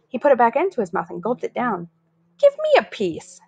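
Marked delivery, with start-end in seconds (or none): 2.4-3.4 bully demanding. Acted. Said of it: "Spider said".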